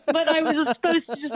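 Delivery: real laughter spilling into the start of the line; laughing. Real, not acted. Actress laughs about playing a Romanian princess.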